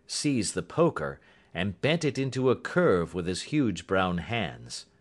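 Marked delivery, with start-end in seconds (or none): none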